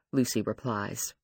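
usual speaking voice

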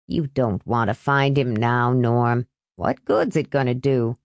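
put-on discouraging older man. A narrator's reading. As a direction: talk him down in a low voice. Older man discourages angry youth.

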